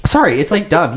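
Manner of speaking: ordinary delivery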